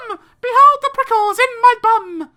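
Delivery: loud, high panicked yell; child shouting for mum. Acted. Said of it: "I shouted".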